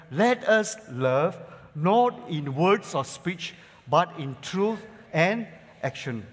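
slow, loud reading of the verse; scripture proclaimed. Acted. Pastor reading the verse with church, slow and loud. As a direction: lean on every word.

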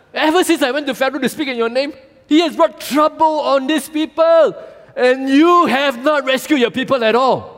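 fast, high, loud mock complaint; frustrated Moses. Moses reads his complaint to God, high fast huffy voice. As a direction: rattle off the grievance in a huff. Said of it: "Moses returned to the Lord and said".

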